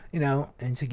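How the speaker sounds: ordinary talk, nothing marked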